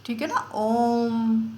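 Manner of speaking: ओम stretched very slowly; long drawn om. Shown as a demonstration. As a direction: hold ओम out like a chant. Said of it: "लंबा तीन second का समय". (ओम को तीन मात्रा तक खींचकर)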